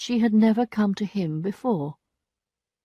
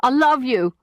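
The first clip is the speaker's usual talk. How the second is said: shouting; 5 semitones higher, 4 dB louder, slower than usual. A narrator's reading.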